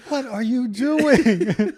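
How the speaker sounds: high, loud, slow mock yell, laughing; mock scream. Diners yelling at a biker in the restaurant, mock scream. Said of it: "people screaming".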